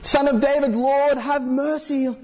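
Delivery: pleading blind man. Acted. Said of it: "And like".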